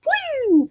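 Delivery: high-pitched celebratory "woo"; high firework whoop. Retold or imitated. Reader showing viewers the balloon popping, high whoop. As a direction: whoop like something bursting into fireworks.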